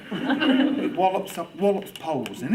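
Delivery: ordinary talk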